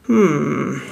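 low, drawn-out hum; grumbled. A narrator's reading. Shopkeeper hearing the boy's story, low grumble. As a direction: grunt it low, mulling it over. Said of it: "brummte Herr Koriander".

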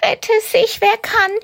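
very high, frantic rabbit thought; a rabbit about to bolt; panicked rabbit. Acted. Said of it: "denkt Karl-Heinz". (panische hohe Kaninchenstimme)

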